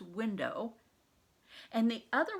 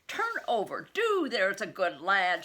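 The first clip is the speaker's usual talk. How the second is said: harsh, loud Cockney nurse; Cockney voice stretched too wide; 8 dB louder than usual. Acted. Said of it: "intending her voice to be soft, but it was not".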